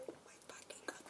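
whispered in disbelief; awed whisper. Real, not acted. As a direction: hush it out, amazed. Awed over the bag.

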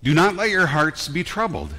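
high, loud benediction; proclaiming. Acted. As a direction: lift it over the congregation.

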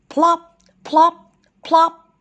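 high, popped plop sounds; imitated plops. A narrator's reading. Pebbles dropped into the pond, imitated plops. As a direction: pop each word high and loud, separately.

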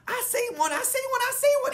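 very high, loud and fast thrilled shout. Acted. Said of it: "he shouted even louder than before".

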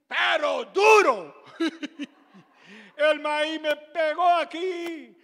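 laughing while telling how the corn hit him. Real, not acted.

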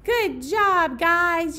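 loud, high cheer; loud praise for the little firefighters; excited cheer. Real, not acted. Cheering children after song.

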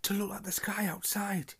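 high, breathy know-it-all whisper. Acted. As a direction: whisper the fact eagerly. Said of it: "He heard Hermione whisper".

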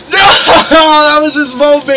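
high-pitched cry of protest; dismayed shout. Real, not acted. Commentator protesting Trey's lost moment, dismayed high shout. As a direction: wail it like a gutted wrestling commentator. Protesting the ruined move in game.

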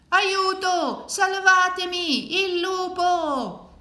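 high, loud, drawn-out yelling; long panicked wail; desperate cry for help. A narrator's reading. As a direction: scream for rescue from the wolf. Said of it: "gridava il poveretto".

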